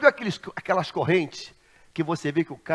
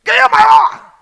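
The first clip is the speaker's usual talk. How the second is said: full shout, much higher and louder; shouted challenge; 18 dB louder, slower than usual. Retold or imitated. Driver shouting a dare at the pastor, full shout. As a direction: bellow the question as a dare.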